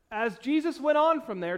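usual speaking voice

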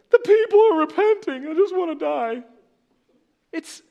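very high, loud whining sulk; pouty Jonah. Retold or imitated. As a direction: whine like a sulking child who wants to die. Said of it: "Jonah's so pouty".

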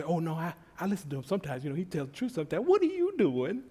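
ordinary talk

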